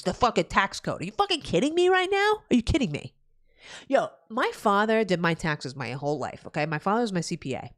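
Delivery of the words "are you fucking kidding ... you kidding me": high, indignant disbelief; outraged. Real, not acted. Streamer rants to chat about the tax code, outraged. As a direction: demand an answer, fed up.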